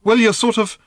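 high, loud, helpless start left unfinished; helpless attempt. A narrator's reading. Girl helplessly describing endless birds, high and unfinished. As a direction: begin to explain, then give up. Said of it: "Random gestured helplessly".

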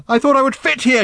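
high, loud outburst; anguished cry. A narrator's reading. Random, feeling she fits nowhere, crying out loudly. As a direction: cry it out at full pitch. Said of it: "she cried".

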